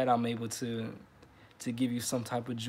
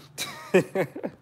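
breathy laugh; 6 semitones higher, 4 dB louder than usual. Real, not acted. Laughs at dirty water.

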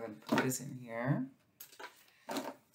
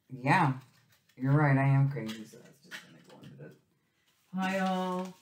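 ordinary talk, nothing marked

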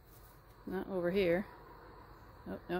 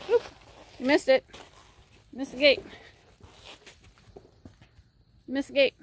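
raised voice calling across the yard; 7 semitones higher, 10 dB louder than usual. Real, not acted. Owner calling to her horse across the field, loud. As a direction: call out to the horse that missed the gate.

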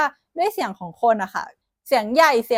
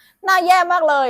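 higher, louder playful teasing jab; joking tease; 7 semitones higher, 5 dB louder than usual. Retold or imitated. Teasing a close friend who asked about his face, playful jab. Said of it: "เราอาจจะเหมือนฟีลล้อเล่นนะว่า".

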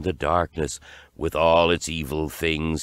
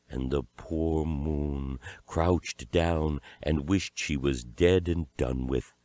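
ordinary delivery

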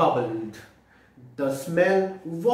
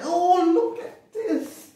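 high, slow, laughing delight; delighted storyteller; excited; 13 semitones higher, 4 dB louder than usual. Acted. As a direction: gasp with glee at the picture. Excited reading to children.

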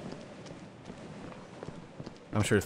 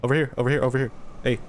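loud, higher call across distance; calling from far away; 4 semitones higher, 3 dB louder, much faster than usual. Real, not acted. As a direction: shout to the dog. Calling a dog in game.

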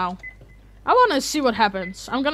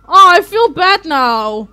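loud and very slow, stretched; real anguish dragged out; slow loud lament; drawn-out guilty wail; 6 semitones higher, 12 dB louder, much slower than usual. Real, not acted. Repeats, slower and drawn out.

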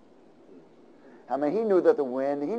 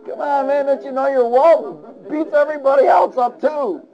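much higher, louder pleading; mock pleading sailor; 10 semitones higher, 4 dB louder than usual. Preacher ribbing Jonah as a sailor, high mock plea. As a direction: whine at Jonah like a desperate sailor.

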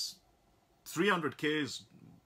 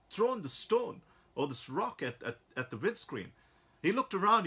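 ordinary talk, nothing marked

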